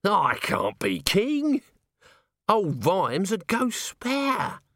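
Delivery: high, slow, drawn-out wail; panicky refusal. Acted. Nobby feels the shadows closing in, wailing. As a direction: plead in comic dread.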